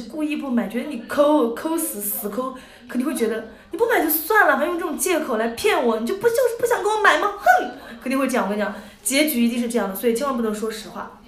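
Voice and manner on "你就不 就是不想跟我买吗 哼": put-on pouty girl. Imagined sulky girl accusing.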